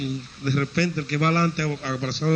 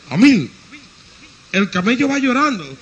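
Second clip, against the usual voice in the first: higher, louder alarmed call; louder, higher call to the rider behind; 7 semitones higher, 6 dB louder than usual. Acted. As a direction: shout back over your shoulder. Camel rider calls out to friend.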